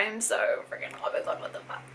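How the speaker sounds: breathless awed whisper; stunned whisper. Real, not acted. Unboxing a gift from Ali, a stunned whisper. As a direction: whisper it, too amazed for voice.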